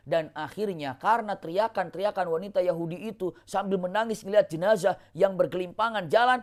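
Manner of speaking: usual speaking voice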